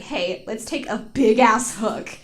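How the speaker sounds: normal talking voice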